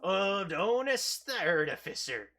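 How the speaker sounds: loud gruff pirate greeting; pirate captain voice. Acted.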